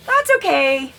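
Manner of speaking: high, bright reassuring lilt; sing-song reassurance. Acted. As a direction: lift it up high to cheer yourself on.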